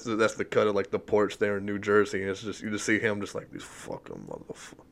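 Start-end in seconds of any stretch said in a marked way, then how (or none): 3.5-4.8 low, breathy under-the-breath grumble; muttered grumble. Retold or imitated. Italian guy on his New Jersey porch, muttered grumble.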